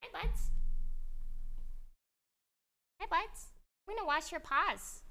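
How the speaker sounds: high-pitched cooing to a puppy; pet talk. Real, not acted. Owner greeting her sleepy dog, high cooing pet talk.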